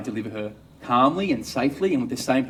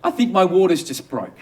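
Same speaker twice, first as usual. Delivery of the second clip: higher-pitched wife calling out; acted calling out; 6 semitones higher, 4 dB louder than usual. Wife calling to husband from the toilet, raised higher voice. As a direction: yell it out as the wife, not full force. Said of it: "yells out".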